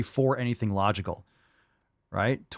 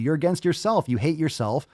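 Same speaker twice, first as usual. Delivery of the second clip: ordinary delivery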